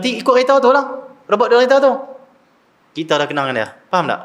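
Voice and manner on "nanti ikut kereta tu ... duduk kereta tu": imitating a small kid arguing, high-pitched; high, raised mock-child insistence; insistent child. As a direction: explain it like an exasperated little kid. Retold child insists to father.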